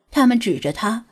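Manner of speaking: ordinary delivery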